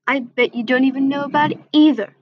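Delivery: ordinary delivery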